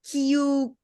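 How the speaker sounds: "Kiyu" said as kee-YOO; pronunciation option Kiyu. Shown as a demonstration. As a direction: say "Kiyu" ending in a yoo sound. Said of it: "confused whether it's Kio".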